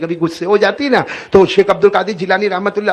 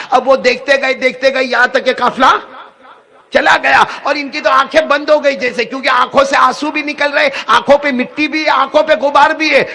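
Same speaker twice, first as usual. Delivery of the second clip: rising fervour; 7 semitones higher, 3 dB louder than usual. Acted. Emotional climax of story.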